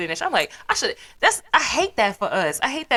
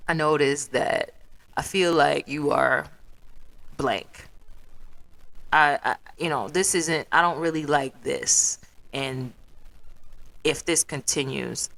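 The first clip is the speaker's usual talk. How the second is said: measured confronting; 4 semitones lower, 3 dB quieter than usual. Retold or imitated. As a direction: choose each word carefully, with pauses. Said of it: "I brought it up to you and I was like".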